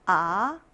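아 gliding upward from low; second tone. Shown as a demonstration. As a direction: Mandarin second tone on 아. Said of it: "사성을 연달아 내보도록".

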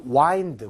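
"wind" as wynd; long-i wind. Shown as a demonstration. Teacher contrasts present with past form, long-i "wind". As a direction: say "wind" to rhyme with kind. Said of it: "발음이 이렇게 달라지는 거예요".

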